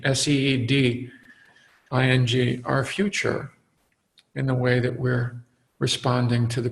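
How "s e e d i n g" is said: spelling out S E E D I N G letter by letter; spelled out. Shown as a demonstration. Teacher spelling out seeding for the listeners, letter by letter. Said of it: "we're seeding".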